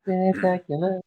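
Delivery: high, stretched-out playful echo. Retold or imitated. Co-host echoing the Spectacular Spider-Man title, playfully high.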